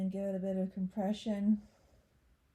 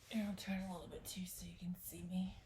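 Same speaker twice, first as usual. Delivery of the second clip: whispered aside; 2 semitones lower, 9 dB quieter, faster than usual. Real, not acted. Aside while turning for camera.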